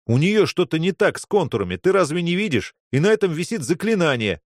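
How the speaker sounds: high, agitated explanation; alarmed warning. Acted. Boy warning of a spell on the patient, alarmed warning. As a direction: warn them urgently.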